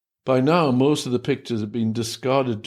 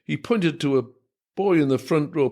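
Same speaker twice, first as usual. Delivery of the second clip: usual speaking voice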